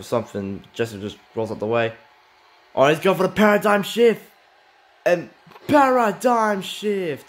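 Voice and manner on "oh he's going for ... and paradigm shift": high and loud, stretching the name of the move; excited finisher call. Real, not acted. Commentator hyping the paradigm shift to viewers, thrilled. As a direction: sell the finisher like the crowd is on its feet.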